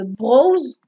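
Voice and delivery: Browse dit avec l'accent français, sans accent anglais; prononcé à la française. Real, not acted. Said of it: "moi, j'ai pas l'accent anglais". (Browse à la française, pas à l'anglaise)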